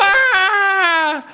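high squealing why; shrill mock cry. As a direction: wail it in fake outrage.

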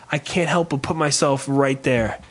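ordinary delivery

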